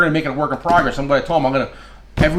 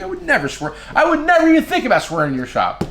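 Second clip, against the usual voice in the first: mock innocent; 10 semitones higher than usual. Mock innocent denial to Ricky.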